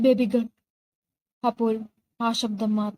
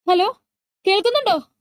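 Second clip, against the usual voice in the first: high anxious call down the phone; much higher worried phone call; 9 semitones higher, 4 dB louder than usual. Acted. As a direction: shout into the dropped phone for an answer. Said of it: "Sidhu അപ്പുറത്തു നിന്നും വിളിച്ചു പറഞ്ഞു".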